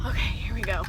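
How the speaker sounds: hushed whisper. Real, not acted. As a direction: whisper it, sneaking in. About to surprise husband.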